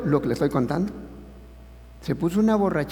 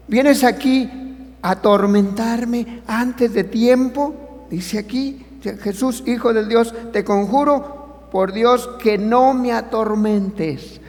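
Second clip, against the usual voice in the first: demon crying out; 6 semitones higher, slower than usual. Acted. Voz del demonio, repetida. (predicador da voz al demonio que grita, agudo y fuerte)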